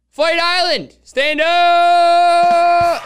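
long drawn-out arena announcer shout; announcer hype call. Acted. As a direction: belt it out like a ring announcer. Hyping up the event, announcer call.

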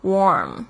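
warm with the ar said like or; or sound. Shown as a demonstration. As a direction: say warm rhyming with form. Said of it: "A R这边是发or的声音".

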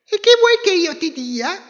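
very high, loud chirpy reply; tiny squeaky bird. Acted. Little bird answers wolf.

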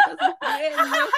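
high laughing squeal. Real, not acted. Mocking the guilty mother.

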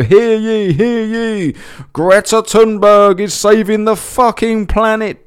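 high, loud, slow proclamation; town crier. Retold or imitated. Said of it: "You gotta go".

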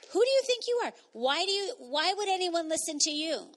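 high, mocking sneer of the accuser; taunting enemy. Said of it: "When the enemy comes to tell me".

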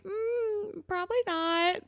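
high-pitched, hesitant doubt; mock doubtful. Friends silently doubting the dad's plan, mock doubtful. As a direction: hum it high, doubtful but holding back. Retells their doubtful unspoken reaction.

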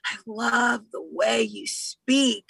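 slow, smitten gushing; imitating her own swooning, drawn out; drooling admiration. Retelling how she drooled over a British accent, slow swooning. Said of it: "like, drooling. I was like".

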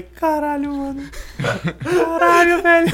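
high squealing laughter; laughing fit. Retold or imitated. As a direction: crack up mid-word. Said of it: "Eu tive um ataque de riso". (ataque de riso)

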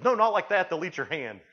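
a loud high alarmed warning; urgent warning shout. Retold or imitated. Dive guide warning the brother-in-law, loud and alarmed. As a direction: snap it out to stop the danger.

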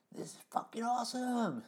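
whispered high imitation of a buddy; imitating the Rev. Retelling the Rev in the van, whispered and thrilled. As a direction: breathe it out like a man in pure bliss. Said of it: "I gotta say it how he would say it".

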